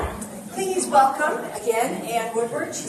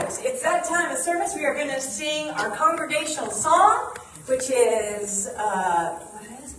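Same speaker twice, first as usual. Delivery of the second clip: ordinary delivery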